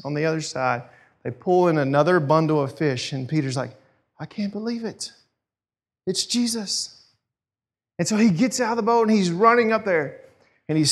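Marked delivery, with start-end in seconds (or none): 4.2-7.0 high, very soft amazement; gasp-like awe, kept small; hushed disbelief. Retold or imitated. Peter recognises Jesus from the boat, hushed disbelief.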